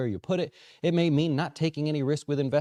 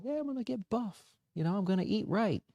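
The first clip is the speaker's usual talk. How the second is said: mock client voice; 6 semitones higher, 5 dB quieter than usual. Mocking a client's new-year goal.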